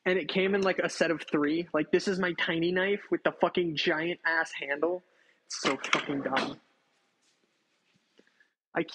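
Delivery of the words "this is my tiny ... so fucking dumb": ordinary delivery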